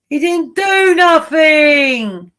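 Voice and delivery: hammed-up shout in his defence; slow hammy yell that he's innocent; mock pleading shout.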